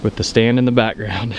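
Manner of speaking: ordinary delivery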